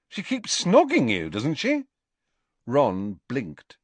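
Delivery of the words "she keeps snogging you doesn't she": higher-pitched puzzled questioning; bewildered. A narrator's reading. Harry puzzles over lovesick Ron's claim, bewildered. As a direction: point out the obvious, baffled, voice lifted.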